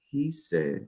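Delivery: very soft, hushed reveal. Shown as a demonstration. Coach shows how to reveal what God said, hushed. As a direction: drop to a confidential near-whisper. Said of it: "Lower your voice for effect".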